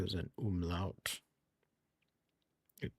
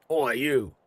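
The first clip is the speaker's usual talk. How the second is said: loud, higher Cockney orc call; 6 semitones higher, 8 dB louder than usual. Shown as a demonstration. Streamer trying an orc voice for a character, loud Cockney accent. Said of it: "like an orc wa, Cockney accent".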